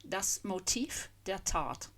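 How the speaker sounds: Das Motiv der Tat said slowly, Motiv ending in F; slow German pronunciation. Shown as a demonstration. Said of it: "stress on the second syllable".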